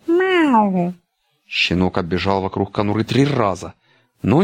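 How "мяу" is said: high mewing meow; cat meow. A narrator's reading. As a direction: mew like a kitten. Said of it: "громко сказал". (кошачье мяуканье)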